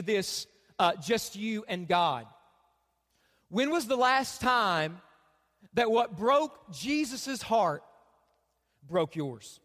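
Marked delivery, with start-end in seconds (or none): 3.5-9.6 solemn preaching question. Acted. Pastor puts the key question to the congregation, slow solemn preaching. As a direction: let each phrase land, then sink to almost nothing.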